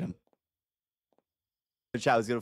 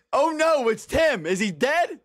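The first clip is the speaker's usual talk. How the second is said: high startled oh no; suddenly panicked and high; alarmed surprise; 13 semitones higher, 4 dB louder, slower than usual. Real, not acted. Finds a dead body.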